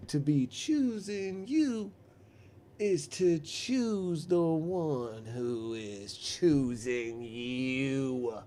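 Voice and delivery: very slow, drawn-out wisdom; ponderous guru-like stranger; mock-profound riddle.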